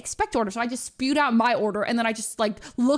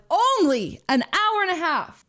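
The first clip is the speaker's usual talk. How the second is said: incredulous; 7 semitones higher, slower than usual. Retold or imitated. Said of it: "And I was like".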